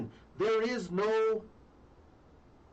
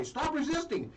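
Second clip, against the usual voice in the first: mock cop shout; 6 semitones higher than usual. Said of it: "then they say".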